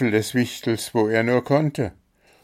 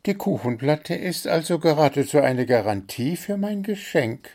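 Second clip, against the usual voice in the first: small bird voice; 9 semitones higher than usual. A narrator's reading. Zwitschi explains his plan.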